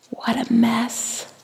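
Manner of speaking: breathy hushed whisper. A narrator's reading. Narrator to children, whispering about the messy room. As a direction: whisper it like a shocked secret.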